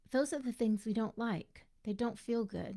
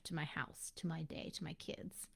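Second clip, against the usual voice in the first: trailing softer into a whisper; fading to a whisper; 3 semitones lower, 9 dB quieter than usual. Retold or imitated. An overwhelmed mom trailing off to a whisper. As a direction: let it die away, drained.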